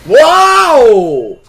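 high, loud, long drawn-out wow; excited wow. Real, not acted. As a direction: stretch it out in amazement. Big play in game.

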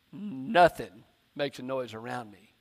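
ordinary talk, nothing marked

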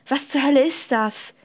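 a touch of outraged yelling; understated exasperated cry; mild narrated shout. A narrator's reading. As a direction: call out over awful noise. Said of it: "schrie Mopsy gegen die schrecklichen Töne".

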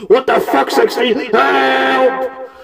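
high, loud panicked cry; panicked yell. Acted. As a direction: shout for help in a panic. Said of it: "He's like".

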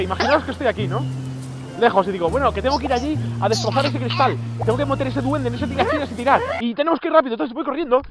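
ordinary delivery

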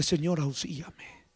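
very low, soft plea; hushed prayer. Shown as a demonstration. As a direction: pray it quietly as if kneeling. Models a humble kneeling prayer.

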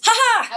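high, spelt-out "ha ha"; triumphant laugh. Real, not acted. Host to team on reaching questions in time, triumphant laugh. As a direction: crow a quick high laugh. Celebrates finishing nearly on time.

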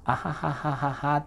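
light demonstrated "ahahaha", little resonance; ordinary Japanese-style laugh. Shown as a demonstration. Coach contrasts laughs without inner resonance, light mock "ahahaha". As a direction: fake a plain, shallow chuckle. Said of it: "っていうより".

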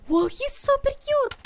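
gasp, then very high squeal; gushing delight. Pretend. Player admires the super cute cat, gushing delight.

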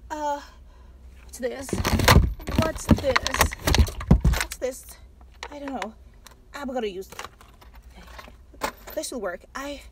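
soft nervous muttering to herself; talking under her breath while fumbling; anxious murmur, barely voiced; hushed muttering. Real, not acted. Fiddling with car alone.

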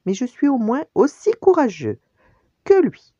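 high, loud cub's boast; exclaiming. A narrator's reading. Simba claiming his father's courage, loud high exclamation. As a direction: cry out defiantly, rising in pitch and volume. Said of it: "s'écria-t-il".

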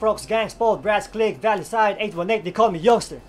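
calling between cells. Retold or imitated. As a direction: shout your set down the tier. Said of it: "This is what I say".

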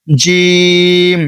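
জিম drawn out very long, tongue middle to palate; Arabic letter jim. Shown as a demonstration. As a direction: stretch জিম slowly as a recitation demo. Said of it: "লাগাইয়া বলবেন".

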